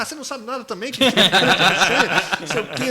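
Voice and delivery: high mock scolding breaking into laughter; hammed-up squabble, loud toward the end; retold quarrel. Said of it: "daí eu brigava com ela".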